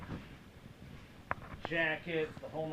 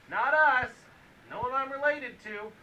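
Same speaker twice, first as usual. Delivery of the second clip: indignant; 8 semitones higher, 10 dB louder than usual. Real, not acted. Indignant denial of blame.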